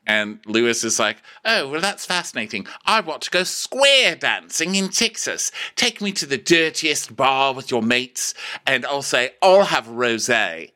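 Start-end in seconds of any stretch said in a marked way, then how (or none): 1.4-10.7 mock-grand Brit. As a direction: stretch it out, hammed-up and higher. Acting out posh Lewis's fascinated reply.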